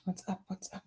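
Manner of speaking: fading to quiet. Pretend. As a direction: let it fade away. Fading repeated greetings.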